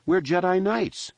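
high-pitched insistent reply; younger brother's voice. A narrator's reading.

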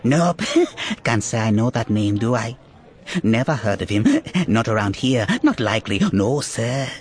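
evasive, chuckling little creature; sly giggling rodent man; cute germ voice. Acted.

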